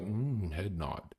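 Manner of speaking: very soft, muted musing; understated reaction. Shown as a demonstration. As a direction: demonstrate a low-key nod of agreement. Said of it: "don't light up when you see my opinions. Just be like".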